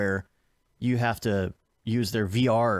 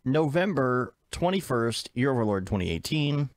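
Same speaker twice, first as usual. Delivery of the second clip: announcer intro; 5 semitones higher than usual. Acted. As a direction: announce the segment like a show host.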